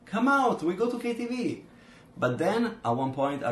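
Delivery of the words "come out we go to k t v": higher, excited call-out; lightly voiced party friend. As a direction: mimic a friend ringing at night. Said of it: "Friends call you".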